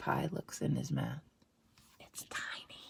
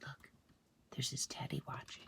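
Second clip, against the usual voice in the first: secretive whisper; hushed aside; 3 semitones lower, 13 dB quieter than usual. Acted. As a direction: lean in and whisper it.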